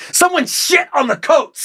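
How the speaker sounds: high, drawn-out comic holler; hammed-up high outcry; mock outcry. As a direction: stretch it out in a high-pitched mock cry.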